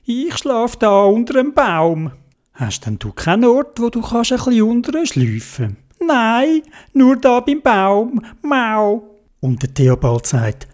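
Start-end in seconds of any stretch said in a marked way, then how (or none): 0.1-9.2 much higher meowing cat; high playful kitty voice. Acted. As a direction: meow like a kitten. Cat answers.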